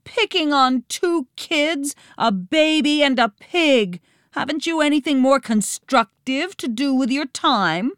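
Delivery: high-pitched, outraged scolding; indignant yelling. A narrator's reading. Captive girl scolding the villains, indignant high yell. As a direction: tell them off at high pitch. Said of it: "Nancy yelled".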